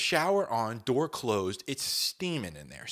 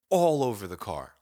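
ordinary talk

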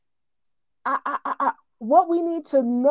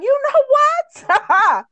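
voicing Paul; 11 semitones higher, 4 dB louder, much slower than usual. Paul's voice, retold scripture.

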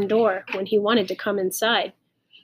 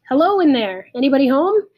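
loud, higher call through doors; 5 semitones higher, 7 dB louder than usual. A narrator's reading. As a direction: holler to people shut inside. Father calls through cellar doors.

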